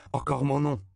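high, loud delighted reply; cheerful. A narrator's reading. Circus man hears his name, says it cheerfully. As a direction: beam with pleasure at the name. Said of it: "dit Bancho avec enjouement".

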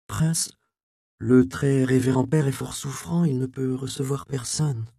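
put-on young monk secretary.

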